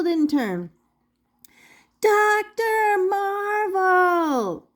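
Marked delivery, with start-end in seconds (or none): 2.0-4.7 slow, drawn-out full yell, much higher; yelling at the top of lungs. Acted. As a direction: bellow his name over the music. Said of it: "yelled together at the top of their lungs".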